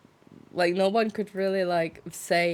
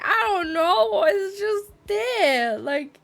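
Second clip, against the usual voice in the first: high, loud, drawn-out bafflement; mock clueless protest; 12 semitones higher, 6 dB louder than usual. Streamer retelling own answer to questioners, hammed-up bafflement.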